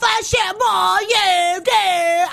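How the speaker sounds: put-on high-pitched British accent tirade; mock British accent. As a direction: play a Brit turning nasty in a hammed-up British accent. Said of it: "whether or not you like Brittany's British accent".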